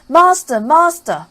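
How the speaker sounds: high, loud alarm cry; harp calling loud. Acted. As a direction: raise the alarm shrilly. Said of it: "the harp called out quite loud".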